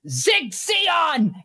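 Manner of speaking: high-pitched zealous slogan cry; rallying cry. Acted. Game master as man throwing a grenade, rallying cry. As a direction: proclaim it like a fanatic's salute.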